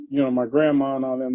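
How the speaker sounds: ordinary delivery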